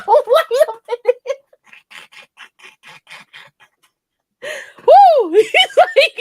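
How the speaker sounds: high, loud cackling through the words; real helpless laughter. Real, not acted. Storyteller losing it at her lover's excuse, helpless laughter. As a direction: crack up too hard to go on.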